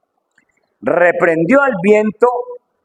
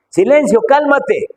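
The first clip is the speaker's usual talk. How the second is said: raised, high, commanding call; Jesus commanding the storm; 9 semitones higher than usual. Retold or imitated. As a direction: order the wind to be still.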